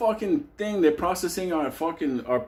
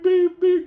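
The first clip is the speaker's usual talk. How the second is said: high monotone "beep" on a steady pulse; alarm clock beeping; 13 semitones higher, 6 dB louder than usual. Retold or imitated. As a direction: mimic an alarm clock, one even pitch. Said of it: "like a fucking alarm clock".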